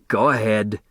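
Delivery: a touch of a grudging grumble; grumbled. Acted. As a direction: give permission reluctantly. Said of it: "he grumbled".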